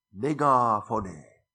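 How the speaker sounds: Megafone drawn out slowly, every syllable clear; Greek word slowly. Shown as a demonstration. Preacher teaching the congregation a Greek word, slow careful pronunciation. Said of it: "Kovalla äänellä kreikaksi".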